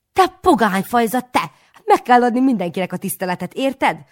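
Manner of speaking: high, loud, fast telling-off; furious scolding. A narrator's reading. Aunt scolds the boy for disrespect, high, loud and fast. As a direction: scold the heathen boy hard.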